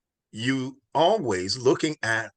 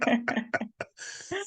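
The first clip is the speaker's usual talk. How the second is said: a real high-pitched burst of laughter; 14 semitones higher, 4 dB quieter than usual. Real, not acted. Host laughs at name mix-up.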